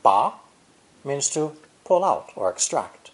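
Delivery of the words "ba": "Ba" gliding upward like a question; rising tone. Shown as a demonstration. Teacher demonstrating a Mandarin tone, rising "Ba". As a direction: say "Ba" with the pitch rising sharply to the end. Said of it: "pronounced with a rising tone".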